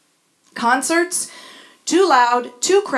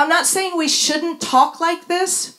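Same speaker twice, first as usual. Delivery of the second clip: ordinary talk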